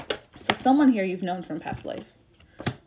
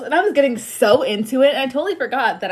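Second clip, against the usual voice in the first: higher, louder and animated; excited; 5 semitones higher, 6 dB louder, faster than usual. Real, not acted. Reader telling viewers about her singing, excited and loud. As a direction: gush about it.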